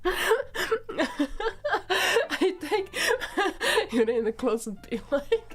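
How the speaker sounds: loud, broken up by giggles; laughing through words. Real, not acted. Player joking about the closet, helplessly laughing. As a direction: struggle to speak while cracking up.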